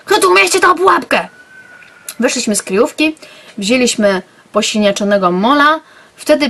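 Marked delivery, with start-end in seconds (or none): 0.0-1.3 high, loud indignant cry; shrill indignant question; character shout. Acted. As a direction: demand it loudly, pitched way up.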